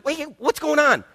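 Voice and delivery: high startled Wait, what's going on; surprised question. Acted. Older brother asks servant what's happening, surprised. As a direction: jolt upward in confusion.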